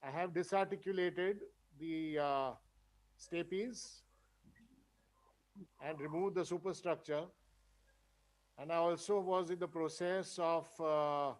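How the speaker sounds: very faint, distant voice; speaking far from the mic; barely audible. Real, not acted. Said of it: "your voice is very low".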